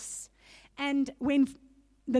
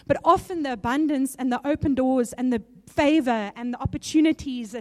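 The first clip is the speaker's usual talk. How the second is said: rising, louder preaching push; 7 dB louder than usual. Acted. Preacher urging the congregation, full-voiced preaching. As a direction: drive home the abundance message to the congregation.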